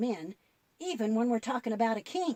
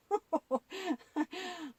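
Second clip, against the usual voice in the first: soft giggle; 6 semitones higher, 5 dB quieter than usual. Acted. Puppet pictures three guys standing.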